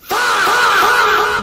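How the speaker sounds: very high, loud shrieking laugh. Real, not acted. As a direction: burst out in a screaming laugh. Reacting to racist clip.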